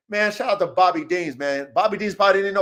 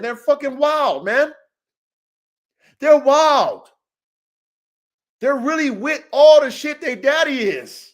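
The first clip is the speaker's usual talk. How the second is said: excited; 6 semitones higher, 5 dB louder than usual. Real, not acted. Hyping his dog's sons.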